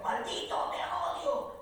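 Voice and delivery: high, dramatic anime cursing; cartoon villain cry. Dubbing actor quoting the censored series lines, melodramatic.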